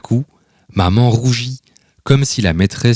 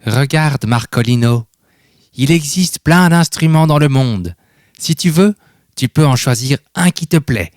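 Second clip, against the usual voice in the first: encouraging grandfather; 6 semitones higher than usual. A narrator's reading. Grandfather encouraging in music shop.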